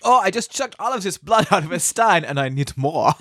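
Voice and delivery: mock German accent. German vampire after draining his stein, mock German accent. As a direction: play a thirsty German blood chugger. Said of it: "it's a German".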